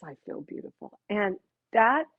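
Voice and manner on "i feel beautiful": whispered, far softer and a little lower; a moved man whispering his answer; breathy whisper. Retold or imitated. Guest's moved reply.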